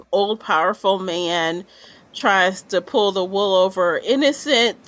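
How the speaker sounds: ordinary talk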